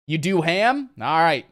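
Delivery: usual speaking voice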